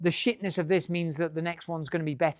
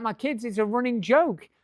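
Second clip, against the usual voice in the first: normal talking voice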